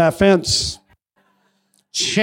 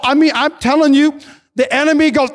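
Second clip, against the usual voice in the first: normal talking voice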